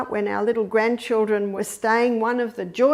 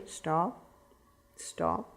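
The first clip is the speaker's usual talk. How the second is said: low, hushed stop fading to a whisper; soft firm stop; 5 semitones lower, 10 dB quieter than usual. Shown as a demonstration. Parent walking in on a crying baby, hushed and firm. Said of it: "You go in".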